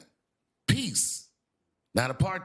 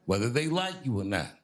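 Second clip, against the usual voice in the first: ordinary delivery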